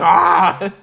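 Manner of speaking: loud mock scream breaking into a laugh; yelp, then crack up; laughing fake scream. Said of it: "She's like".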